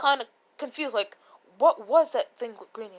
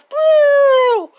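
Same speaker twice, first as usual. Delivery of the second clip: long, high, loud explosion noise; booming vocal sound effect; super loud boom; 13 semitones higher, 12 dB louder than usual. Retold or imitated. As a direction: imitate a huge drawn-out blast. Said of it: "it's super loud 'cause all you hear".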